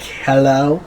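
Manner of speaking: lightly voiced fancy phone greeting; a hint of put-on poshness, lilting up; fancy man. Answering his flip phone, lightly voiced fancy man. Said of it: "look like a fancy man".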